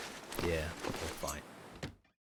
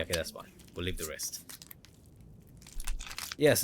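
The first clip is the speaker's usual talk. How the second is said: ordinary delivery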